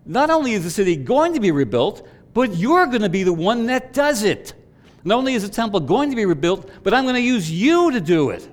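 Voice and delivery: loud and high, driving each line harder; rally the congregation with rising fervour; full-throated sermon build; 1 preaching at full force. Real, not acted.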